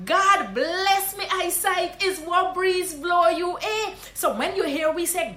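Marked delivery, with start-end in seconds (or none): 0.1-3.9 slow, high Guyanese Creole welcome; Guyanese greeting. Shown as a demonstration. Guyanese teacher welcoming a long-absent friend. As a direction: sing it out Guyanese-style. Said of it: "is this one".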